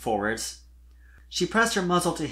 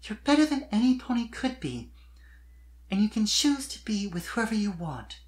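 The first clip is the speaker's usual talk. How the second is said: put-on higher young mare's voice, sure of herself; earnest declaration; 8 semitones higher than usual. A narrator's reading. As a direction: declare it firmly, to lift him up. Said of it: "she declared suddenly".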